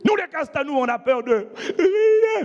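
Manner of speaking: put-on high, slow, tearful child's vow; child declaring. Daughter repeating her father's words while crying, high and slow. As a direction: declare it through tears like a brave little girl.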